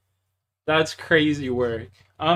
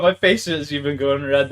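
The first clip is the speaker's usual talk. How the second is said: normal talking voice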